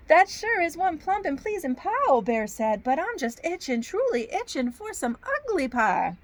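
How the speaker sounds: animated Southern storybook bear; Southern accent. Acted. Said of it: "I get a Southern accent".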